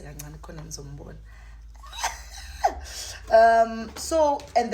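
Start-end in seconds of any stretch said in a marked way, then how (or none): 1.2-3.2 very high breathy giggle; giddy squeal laugh. Real, not acted. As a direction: squeal with delight.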